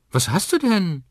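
put-on high, loud child's voice; little girl. Twin sister asks Liam what's wrong, high child voice.

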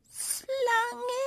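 very high, tearful hiccupping question; sobbing with hiccups. A narrator's reading. Little kitten asking through sobs and hiccups, tearful. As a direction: choke it out through tears. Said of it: "hat einen Schluck auf vom Weinen".

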